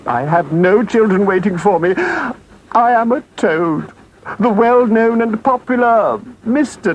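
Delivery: high, pleading, melodramatic Toad; supplication. Acted. Toad begging the engine driver to save him, high-pitched pleading. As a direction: beg for mercy in a high, theatrical wail. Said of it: "raised his paws in supplication".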